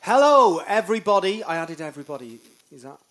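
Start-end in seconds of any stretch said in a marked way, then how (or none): 0.0-1.4 loud, high hello to everyone; big showman's welcome, pitched up; showy greeting. Acted. Hamming up a podcast greeting.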